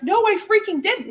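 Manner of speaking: retold indignant denial. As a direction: insist you didn't, half-playful. Retold argument with delivery guy.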